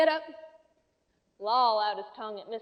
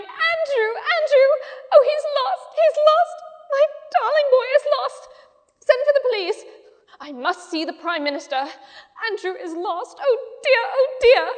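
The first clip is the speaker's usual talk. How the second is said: much higher, much louder frantic wailing; frantic crying out; 13 semitones higher, 11 dB louder than usual. A narrator's reading. Miss Lark calling wildly for her lost dog, frantic. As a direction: shriek in panic for a lost pet. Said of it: "racing about, waving her arms and crying".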